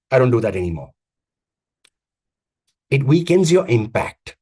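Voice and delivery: normal talking voice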